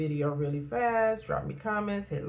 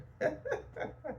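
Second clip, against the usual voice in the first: soft laugh; 10 semitones higher, 8 dB quieter than usual. Real, not acted. Laughing at her journal entry.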